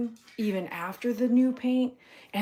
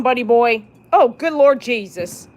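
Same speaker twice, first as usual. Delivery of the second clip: normal talking voice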